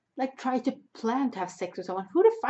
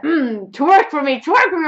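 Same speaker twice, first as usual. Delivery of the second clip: loud, pushy club-guy voice, rising higher; mock lecherous guy; 6 semitones higher, 13 dB louder, slower than usual. Guy behind her wanting twerking, mock lecherous demand. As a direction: demand it loudly like a club creep.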